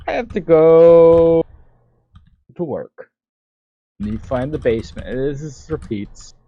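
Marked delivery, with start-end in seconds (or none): 0.1-3.1 slow, much louder, higher put-on voice; put-on character voice. Player voicing Catalina leaving for work, hammed-up slow. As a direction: announce it slowly in a silly dramatic voice.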